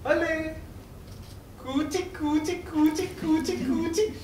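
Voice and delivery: high, soft, slow cooing; baby talk. Retold or imitated. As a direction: coo at a baby. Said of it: "they'll be like".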